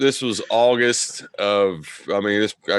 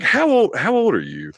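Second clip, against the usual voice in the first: incredulous; 9 semitones higher than usual. Retold or imitated. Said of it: "I was like".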